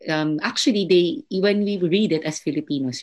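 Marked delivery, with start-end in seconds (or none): none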